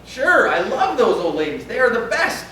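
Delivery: ordinary talk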